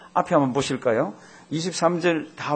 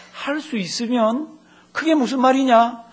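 ordinary delivery

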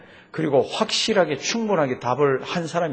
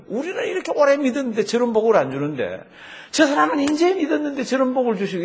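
higher-pitched mock grumble; imitated envious complaint; 8 semitones higher than usual. Longtime believer grumbling about newcomers getting blessed, imitated high-pitched complaint. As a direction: voice a resentful complaint in a raised pitch.

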